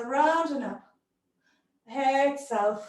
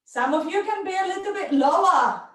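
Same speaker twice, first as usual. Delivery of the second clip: urging; 6 semitones higher, 3 dB louder, faster than usual. Real, not acted. Teacher urging class lower.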